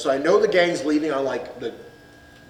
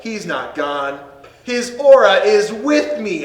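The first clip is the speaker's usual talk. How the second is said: louder, slower, higher dramatic pronouncement; solemn hero line; 5 semitones higher, 6 dB louder, slower than usual. Retold or imitated. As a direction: deliver it like a movie hero's farewell. Dramatic line from death montage.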